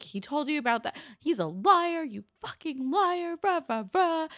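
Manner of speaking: hammed-up furious rant; mock screaming. [name] screaming at Sean at the grave, mock rage. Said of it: "[name] screams at Sean".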